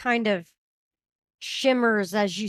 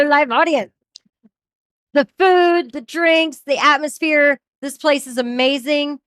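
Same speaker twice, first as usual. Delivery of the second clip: loud, higher, upbeat host voice; show host announcement; 5 semitones higher, 8 dB louder than usual. Acted. Host welcoming the live audience back, loud and upbeat.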